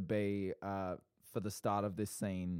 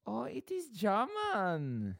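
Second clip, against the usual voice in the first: put-on drawn-out, higher-pitched German accent; 6 semitones higher, slower than usual. Prompt drawn for the improv game, in a German accent. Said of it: "doing a German accent".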